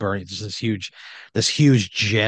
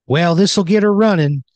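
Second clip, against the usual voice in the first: loud, higher-pitched mechanic character; Randy Wrench; 8 semitones higher, 10 dB louder than usual. Acted. Randy to player on the edge of the engine, hammed-up confidence. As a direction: punch it out high and loud. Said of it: "and he says".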